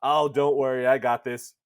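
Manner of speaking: loud, puffed-up false confidence; Weiss. Acted. Self-defeating book reassuring Nier, loud and overconfident. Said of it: "just self-defeatingly beating, like".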